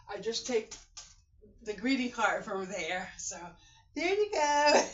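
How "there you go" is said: laughing. Real, not acted. Laughs while handing out a card.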